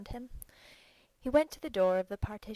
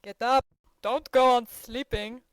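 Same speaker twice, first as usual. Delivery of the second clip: rousing shout; 5 semitones higher, 13 dB louder than usual. A narrator's reading. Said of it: "said Yashvin ... giving Petritsky ... a prod".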